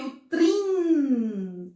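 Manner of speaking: "trim" held long as one unbroken syllable; syllable held together. Shown as a demonstration. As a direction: stretch "trim" out as a single sound. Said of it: "Eu falei junto".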